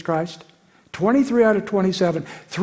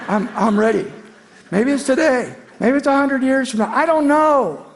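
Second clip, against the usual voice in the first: eager and higher, voice lifting; hopeful excitement; 7 semitones higher, 3 dB louder than usual. Real, not acted. Preacher longing for the Lord's return, eager lifted voice. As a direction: let the hope burst out higher.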